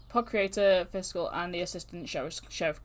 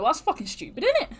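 mocking scold; 10 semitones higher, 6 dB louder, faster than usual. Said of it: "I was like".

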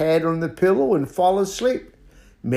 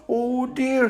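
high hooting owl voice; fretful, high-pitched owl; 10 semitones higher than usual. Acted. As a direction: speak high like a worried owl. Owl's line in the story.

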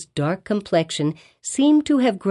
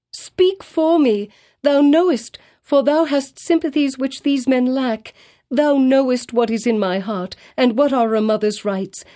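wild appeal; 5 semitones higher than usual. A narrator's reading. Hester begging the pastor, high desperate cry. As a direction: beg as if near madness. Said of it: "this wild and singular appeal".

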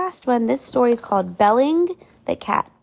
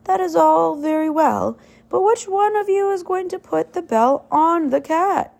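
put-on a raised, challenging old mouse; 7 semitones higher than usual. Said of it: "an old mouse got up and said".

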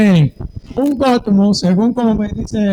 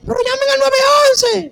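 high, urgent cry for help; mock panicked bystander; frantic call. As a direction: shout for someone to call 911. Acting out bystanders at accident.

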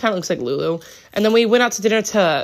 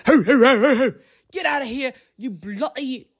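London Brit; 5 semitones higher than usual. Retold or imitated. An angry London restaurant man chasing off a homeless man, mock British yell. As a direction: bark it like an angry London shopkeeper. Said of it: "this big London Brit started going".

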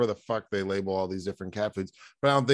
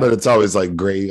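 loud outburst; 11 dB louder than usual. Real, not acted. Excited interjection, loud.

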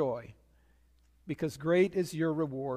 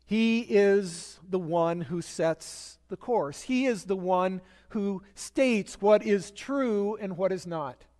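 slow emphatic preaching; slower than usual. Acted.

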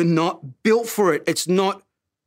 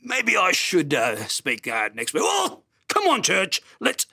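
Brian Houston; 2 semitones lower than usual. Acted. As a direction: slip hesitantly into a hammed-up preacher impression. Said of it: "hear my voice start to sort of turn".

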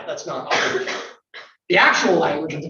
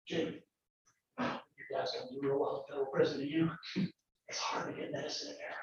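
a prosecutor's veiled threat, much softer and hushed; menacing prosecutor; 2 semitones lower, 4 dB quieter than usual. Pretend. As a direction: lean in and quietly threaten his dad. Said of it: "let's say I'm a federal prosecutor".

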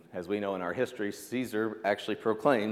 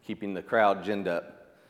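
normal talking voice

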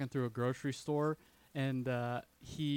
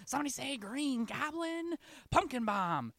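high-pitched, excited comic entrance; hammed-up goofy villain; 12 semitones higher, 4 dB louder than usual. Guy in novelty green glasses butting in, hammed-up villain call. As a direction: burst in with a cartoonishly high call. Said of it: "some guy in novelty green glasses comes out and says".

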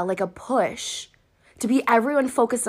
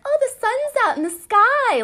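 carefree stroller voice; 4 dB louder than usual. Retold or imitated. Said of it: "just like".

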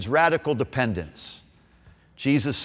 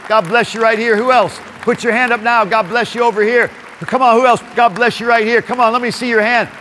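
getting louder; 7 semitones higher, 4 dB louder than usual. Real, not acted. Blessing raised hands, building.

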